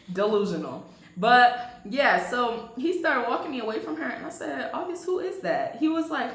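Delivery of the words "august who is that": high, probing question; retold pointed question. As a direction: press him for an answer, pitched up. Retold confrontation, first asking.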